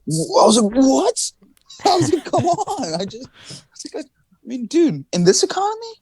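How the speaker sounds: high, cracking disbelief with a laugh breaking in; incredulous disbelief. Retold or imitated.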